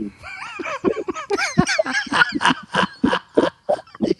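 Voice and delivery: long, high, tearful laughter; real laughing fit. Real, not acted. Admitting he was a fool, laughing hard. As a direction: laugh helplessly till near crying.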